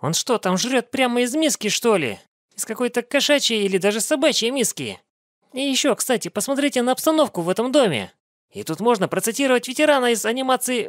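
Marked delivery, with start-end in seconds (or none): none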